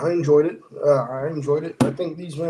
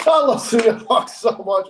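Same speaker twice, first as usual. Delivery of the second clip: high, loud fan adoration; gushing; 8 semitones higher, 8 dB louder than usual. Real, not acted. Fan declaring love for a favorite wrestler to co-hosts, gushing. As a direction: gush it with helpless delight, pitched up.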